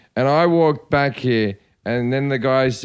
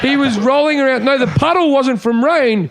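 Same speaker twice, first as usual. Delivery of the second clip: excited protest; 9 semitones higher, 3 dB louder than usual. Real, not acted. Excited retort in banter.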